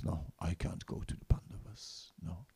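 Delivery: a humble monkey's hushed no; whispered refusal. Retold or imitated. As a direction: whisper it, softly firm. Hanuman quietly refuses.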